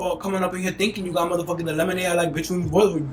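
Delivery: usual speaking voice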